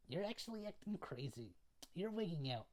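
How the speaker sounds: high-pitched mock girlfriend voice; lightly exasperated girl, pitched high; imitated girlfriend. Girlfriend scolds boyfriend in retelling.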